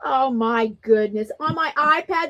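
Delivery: usual speaking voice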